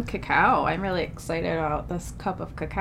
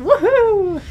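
loud high whoop; cheering whoop; 12 semitones higher, 8 dB louder than usual. Real, not acted. Cheering the segment intro.

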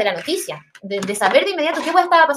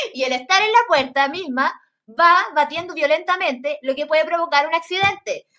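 lectura de artículo; 5 semitones higher than usual. Shown as a demonstration. Lee un artículo web.

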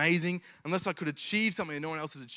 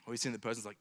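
ordinary talk, nothing marked

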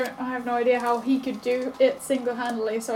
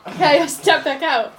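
ordinary talk, nothing marked

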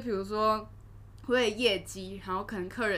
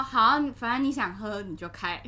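ordinary delivery

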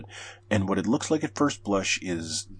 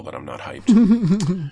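high, loud and slow, stretching every word; drawn-out not hyped; 11 semitones higher, 7 dB louder, much slower than usual. Acted. Host tells co-hosts he is not excited, drawn out and emphatic. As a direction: lean hard on the denial, slowly. Deadpan verdict on a game trailer.

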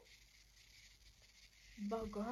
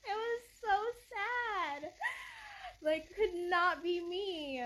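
much higher, louder, slow wail laughing through mock tears; laugh-crying; 9 semitones higher, 6 dB louder, slower than usual. Real, not acted. Pitying the wife in the video, mock wailing laugh.

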